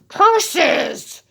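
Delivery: high, loud villain curse; wolf cursing. A narrator's reading. Foiled wolf at the door, hammed-up curse. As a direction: spit it out, foiled.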